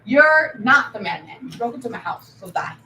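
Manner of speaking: ordinary talk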